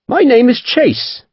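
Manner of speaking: high, slow courteous Devonshire captain. A narrator's reading. Captain introducing himself to the merchant, courteous and slow. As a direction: introduce yourself with polite grace. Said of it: "he said courteously".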